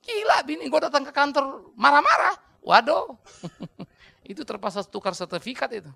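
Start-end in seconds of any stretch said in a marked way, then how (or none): 0.1-3.2 mock dismay. Colleague retelling his wife's office outburst.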